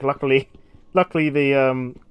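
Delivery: ordinary talk, nothing marked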